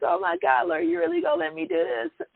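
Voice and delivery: high-pitched, half-crying disbelief to God; retold crying. Retelling her answer to God, high and half-crying. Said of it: "crying, like".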